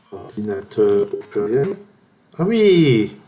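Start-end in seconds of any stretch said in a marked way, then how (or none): 2.4-3.1 excited realization. Real, not acted. Realizing a clue in word game.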